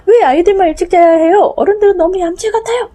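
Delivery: put-on high, loud protesting child; child's voice. Quoting the child's bedtime complaint, high and loud. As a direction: pout it out like a cheated kid.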